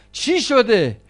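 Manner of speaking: sudden high, loud cry of alarm; alarmed question. Acted. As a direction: burst out in shock at a collapse. Brother reacts to the collapse.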